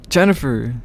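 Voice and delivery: mild startled exclamation; surprised cry of a name; exclaimed. A narrator's reading. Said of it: "Doctor Prescott exclaimed".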